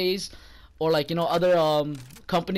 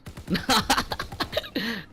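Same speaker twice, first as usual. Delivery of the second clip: laugh; 4 semitones higher, 3 dB quieter than usual. Real, not acted. Laughs at himself.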